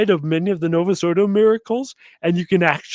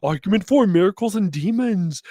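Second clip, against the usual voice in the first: ordinary delivery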